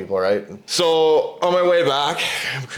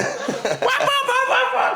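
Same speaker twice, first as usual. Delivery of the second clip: very high revving engine noise; imitated engine revs. Rider wheelies away from the man, imitated revving. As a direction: mimic a quad roaring off in bursts.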